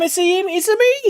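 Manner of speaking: high-pitched mock Italian accent; playful, high Italian accent. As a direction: exclaim it in a cartoonish Italian accent. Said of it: "you're doing an Italian accent".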